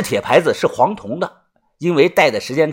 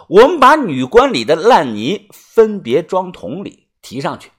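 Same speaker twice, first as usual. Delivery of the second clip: usual speaking voice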